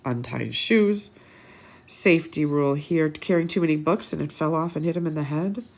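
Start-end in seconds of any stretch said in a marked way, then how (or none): none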